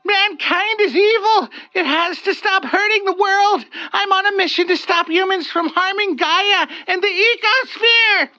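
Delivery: high strained screech. Acted. Olga screeching back at the wraith. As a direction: screech it in defiance. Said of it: "Olga screeched".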